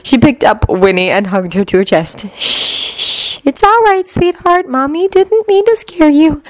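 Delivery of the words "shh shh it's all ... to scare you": put-on calm the baby down gently; soothing. A narrator's reading. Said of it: "she soothed the crying child".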